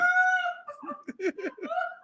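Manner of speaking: high-pitched wheezing giggle. Real, not acted. Host cracking up at a chat comment, high helpless giggle.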